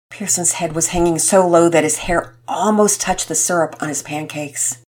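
ordinary delivery

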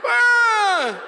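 high laughing wail; wailing cry. Retold or imitated. Boy caught by mom, high wail. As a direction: let out a kid's wail.

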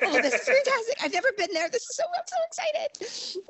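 very high, gushing delight; excited gushing. Retold or imitated. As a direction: overflow with excitement, way up in pitch. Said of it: "I was so happy".

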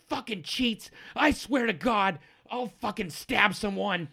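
high-pitched furious halfling rant; acted outburst of a hot-tempered halfling; angry yelling. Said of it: "as he's yelling about cheats".

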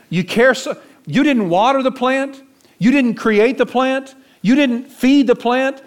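high and loud, hammering each line; rebuking God. Acted. God scolding Jonah over the plant, high and forceful. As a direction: drive home the rebuke, rising and loud.

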